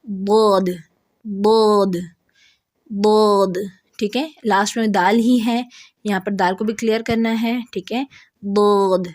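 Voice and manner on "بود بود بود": بود with a full heavy back-vowel sound; heavy letter demo. Shown as a demonstration. Teacher modeling بود as a heavy letter. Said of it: "next ہمارا letter ہے".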